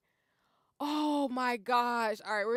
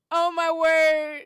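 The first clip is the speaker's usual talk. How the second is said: ordinary delivery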